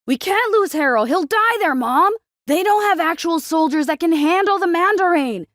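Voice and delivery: high, loud, desperate begging; frantic teenage plea; pleading teen son. A narrator's reading. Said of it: "John pleaded".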